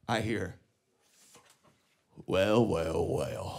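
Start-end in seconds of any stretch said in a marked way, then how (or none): 2.2-3.6 put-on low, drawn-out old lady purr; sly old woman. Old woman sizing him up at the bar, low purr.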